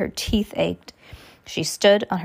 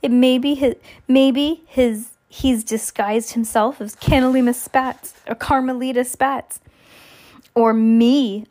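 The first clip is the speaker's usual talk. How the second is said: higher-pitched, teasing figure; mocking joker; 4 semitones higher, 3 dB louder than usual. A narrator's reading. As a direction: tease with silly guesses in a raised voice. Nero making mocking jokes.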